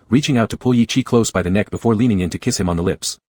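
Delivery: ordinary delivery, nothing marked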